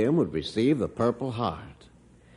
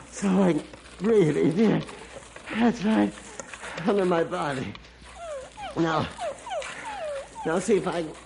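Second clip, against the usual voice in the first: higher, breathless encouragement to the dog; trapped man praising his dog as he digs; strained coaxing; 6 semitones higher than usual. Acted. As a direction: urge the dog on while still pinned.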